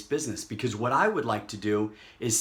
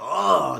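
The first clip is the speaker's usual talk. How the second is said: loud, drawn-out mock groan; exaggerated ow-like "oh"; pained "oh"; 4 semitones higher, 8 dB louder than usual. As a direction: cry out as if stubbing a toe. Acted cry on stubbing a toe.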